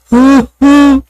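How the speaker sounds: high, loud, breathless claim; Calvo's voice. Retold or imitated. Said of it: "sabi ni Calvo".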